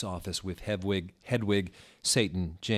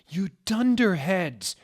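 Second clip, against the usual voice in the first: put-on high, loud slow scream of an old wizard; screaming Dumbledore; 12 semitones higher, 7 dB louder than usual. Dumbledore screaming as they vanish in time. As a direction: yell the insult after them. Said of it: "screamed Dumbledore wisely".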